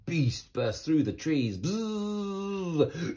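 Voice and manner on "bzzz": high buzzing bee noise. Acted. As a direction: buzz like a giant bee.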